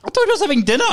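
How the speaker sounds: high-pitched, exasperated protest; worked-up retelling of a row; retold indignation. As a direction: argue back as if wronged. Acted phone quarrel, indignant comeback.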